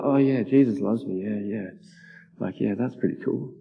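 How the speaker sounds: jaded believer, unimpressed; bored and jaded. Retold or imitated. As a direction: mumble it like old news. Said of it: "I'm like".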